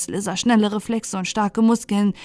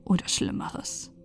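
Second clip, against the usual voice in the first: ordinary delivery, nothing marked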